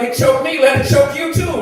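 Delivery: worked-up comic protest; indignant retort. Acted. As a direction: throw it back at them, loudly. Acted retort over dry meatloaf.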